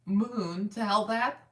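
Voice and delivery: MOON TELL THAT drawn out slowly, rising at THAT; puzzled checking question. Real, not acted. Wife checks the account name her husband gave, slow questioning repeat.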